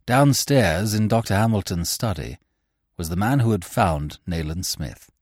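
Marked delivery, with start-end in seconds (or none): none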